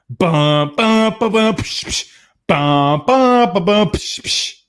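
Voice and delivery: hummed drum and cymbal beat, pitch climbing toward the end; beatbox the wedding march buildup; mock wedding drumbeat. Waiting at the altar, he beatboxes the entrance music as mock drums.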